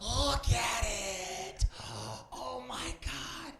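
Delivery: slow, high, awed exclaim; mock awe. Real, not acted. New comic marvelling at the venue, in mock awe. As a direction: gasp at the room as if amazed.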